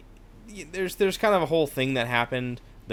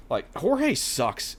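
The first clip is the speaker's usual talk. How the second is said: normal talking voice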